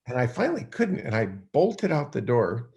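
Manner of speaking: normal talking voice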